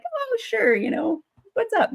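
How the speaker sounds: high, bright-eyed oh sure; chirpy reply. Retold or imitated. Answering the doll man, mock chirpy and high. Her cheerful reply to stranger.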